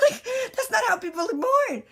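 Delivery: high, fast, talking through laughter; laughing. Real, not acted. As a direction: still cracking up. Laughing at the doctor's line.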